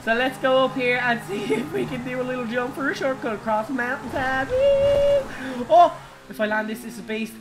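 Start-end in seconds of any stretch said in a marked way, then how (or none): 4.5-5.7 high whooping cheer; excited whoop. Real, not acted. Streamer launching car off a cliff, excited whoop. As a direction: let out a woo mid-jump.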